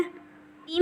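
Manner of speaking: breathy whisper of a name; softly whispering so as not to disturb her; gentle whispered call. Acted. Softly calling the sick sister.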